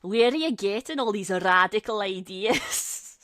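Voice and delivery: loud imitation of a grumpy old man; mock an indignant older friend, loudly; grumbling old-timer, played big.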